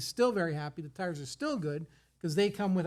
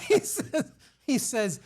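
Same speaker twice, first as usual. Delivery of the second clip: laughing; 6 semitones higher, 6 dB louder, slower than usual. Real, not acted. Laughing while retelling.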